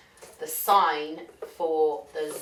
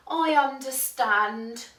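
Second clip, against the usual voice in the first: mildly resigned, slightly raised voice; sighing; 6 semitones higher than usual. A narrator's reading. Duck accepting the animals' refusal, sighing. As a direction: let it out with a small sigh. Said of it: "sighed Quentin".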